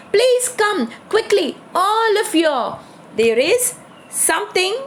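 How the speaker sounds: calling out. A narrator's reading. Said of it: "calling to his family".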